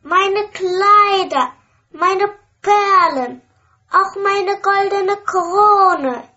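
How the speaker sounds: high, loud, sing-song offer; the princess gushing to get her ball back; eager promise. A narrator's reading. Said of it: "sagt die Königstochter".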